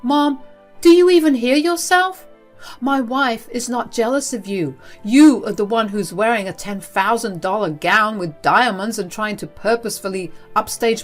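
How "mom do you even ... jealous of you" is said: high, loud, exasperated son; indignant outburst, pitch raised; yelling at mom. A narrator's reading. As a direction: yell in disbelief at your mother. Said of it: "Sebastian continued to yell at her".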